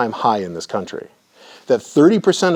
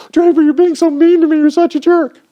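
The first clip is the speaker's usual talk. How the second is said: much higher, louder whining falsetto; whiny mock employee; 8 dB louder than usual. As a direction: whine like a wounded employee, hammed-up. Employee whines at boss Draper.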